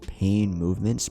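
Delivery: plain reading voice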